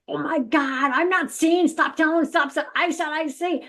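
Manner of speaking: hint of a frantic panic; holy fit. Arguing with the guides in her head, a mock panicky protest. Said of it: "I was having a holy fit".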